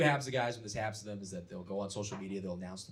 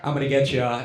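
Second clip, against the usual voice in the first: mock flirty; 3 dB louder than usual. Sexting voice, acting flirty.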